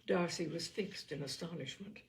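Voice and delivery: plain reading voice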